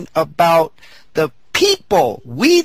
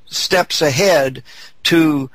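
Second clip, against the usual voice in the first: usual speaking voice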